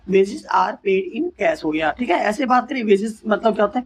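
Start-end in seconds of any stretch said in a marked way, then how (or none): 0.1-1.6 slow, word by word; slow repeat. Shown as a demonstration. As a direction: repeat it slowly for the class. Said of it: "repeat of example".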